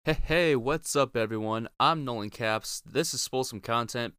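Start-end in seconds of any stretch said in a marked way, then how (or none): none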